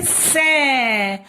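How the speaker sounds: सै held long, gliding down; drawn-out syllable. Shown as a demonstration. Teacher showing स with its matra, drawn-out सै. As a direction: stretch the vowel of सै. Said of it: "तो बोलेंगे".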